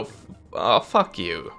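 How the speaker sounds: ordinary delivery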